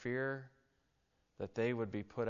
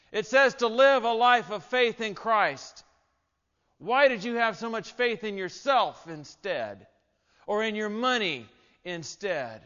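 loud and higher, building to a cry; preaching at full force; 8 semitones higher, 12 dB louder than usual. Acted. Pastor warning the congregation about judgment, in full-force preaching. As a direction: drive the warning home like a fiery preacher.

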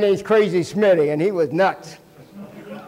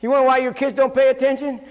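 normal talking voice

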